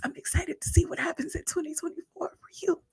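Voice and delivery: high choked whisper through tears; tearful whisper. Real, not acted. Mentor wishing her mentee well, tearful high whisper. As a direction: push the hope out while crying. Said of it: "feel that from my heart".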